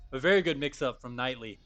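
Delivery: normal talking voice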